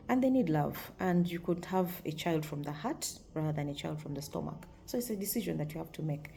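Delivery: ordinary talk, nothing marked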